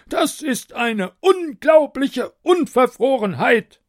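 high, loud, slow outraged cry; roaring tyrant king. A narrator's reading. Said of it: "rief der König".

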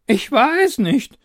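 high, loud protesting voice; defensive denial. Acted. Taster fends off the accusation, high and defensive. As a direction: fend off the blame. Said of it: "sagte Vorkoster Edward abwehrend".

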